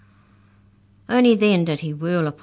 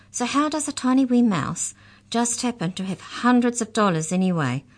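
high-pitched, insistent demand; 8 semitones higher than usual. A narrator's reading. Maury grills Mallory about the money, demanding. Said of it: "demanded Maury".

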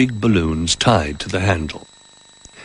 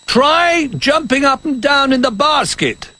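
high, slow call to the basket; old bear calling up to the floating toys; 3 dB louder than usual. A narrator's reading. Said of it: "he called".

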